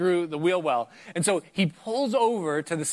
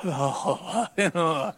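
rueful laugh. Retold or imitated. Pipe leaking discovered.

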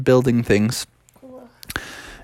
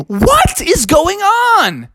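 high, slow, startled bear; a startled little bear; surprised cub; much slower than usual. Acted. Bubby finds the lumber snatched, startled.

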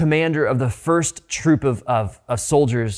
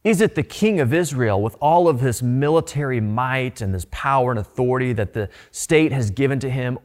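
ordinary talk, nothing marked

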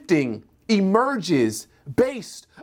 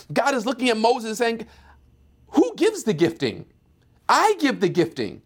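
preaching at full force; 7 semitones higher than usual. Acted. Pastor voices God's answer to Moses, building to a climax. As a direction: build each line to a peak.

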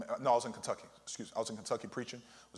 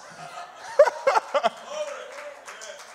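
delighted laugh; 6 semitones higher than usual. Real, not acted. Preacher laughs at story's end.

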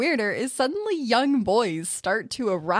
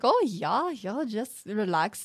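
slow, lightly put-on German accent; a hint of a fake German teacher accent; 2 semitones higher, slower than usual. Imitating a fake German voice coaching the girl, slow German accent. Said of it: "this German-sounding voice".

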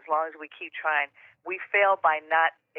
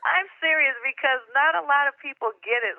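excited; 8 semitones higher than usual. Real, not acted. Gushing thanks to the host.

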